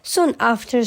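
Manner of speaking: ordinary delivery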